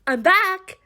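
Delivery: high, sing-out announcement; cheery arrival call. Acted. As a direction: announce your return to the whole room. Calls out on arriving home.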